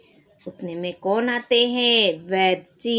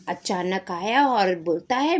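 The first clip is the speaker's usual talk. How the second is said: ordinary talk, nothing marked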